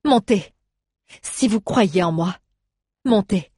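urgent command. Acted. Urging friends onto the rampart wall.

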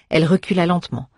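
plain reading voice

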